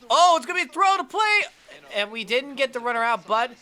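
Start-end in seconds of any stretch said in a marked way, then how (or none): 0.1-1.4 suddenly high, loud and fast; blurting out the play as it happens; excited. Real, not acted. Gamer calls a close play, high and excited. Tense play unfolding in game.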